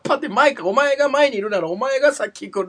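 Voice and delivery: high, exasperated arguing; worked-up. Real, not acted. Host argues about who boards the escalator first, worked-up. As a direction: argue your grievance at full tilt. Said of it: "一旦落ち着こう".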